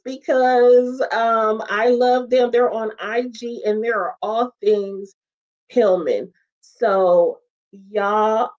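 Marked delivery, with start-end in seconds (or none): none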